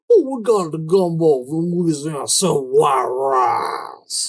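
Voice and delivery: loud, hammed-up Cajun drawl, last words stretched right out; mock Cajun accent. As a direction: ham up a slurred Cajun impression. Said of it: "it sounds kinda like that".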